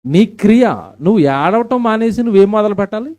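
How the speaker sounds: full-force preaching. Acted. Pastor challenging the women in church, loud. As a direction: drive the question home to the crowd.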